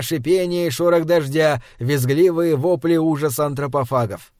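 usual speaking voice

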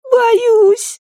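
put-on very high child voice; squeaky, frightened boy; scared little boy. A narrator's reading. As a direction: confess fear like a small child.

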